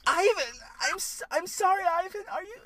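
high-pitched, pleading apology to Ivan; playing the co-host. Co-host apologizing to Ivan in a mock scene, high-pitched and halting. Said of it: "no, I'm you".

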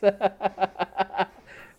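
high, loud burst of laughter. Real, not acted. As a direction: laugh hard at your own joke. Laughs at own retort.

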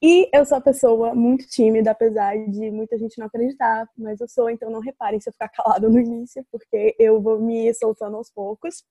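ordinary talk, nothing marked

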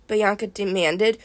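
usual speaking voice

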